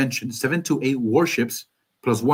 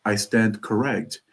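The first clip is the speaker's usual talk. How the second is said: ordinary talk, nothing marked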